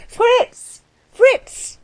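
very high-pitched hail; calling out. A narrator's reading. Anna summoning the coachman, calling out. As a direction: call a name across the yard. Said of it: "she began to call".

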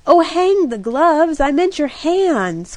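exasperated cry, holding back a laugh; cried out. A narrator's reading. Jack brushes off Kitty's gloves, crying out half amused. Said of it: "cried Jack".

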